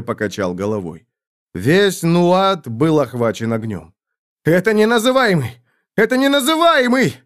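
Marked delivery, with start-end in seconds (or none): 4.4-7.1 high cry of alarm, climbing on the repeat; panicked cry. A narrator's reading. Terrified Roderick recognises the unnameable's fire, high panicked cry. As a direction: cry out in mounting terror. Panicked soldier sees the enemy's strike.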